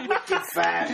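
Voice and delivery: put-on Wicked farted in a light Boston accent, r dropped; Boston accent, Wicked fahdid. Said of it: "That's how he says it".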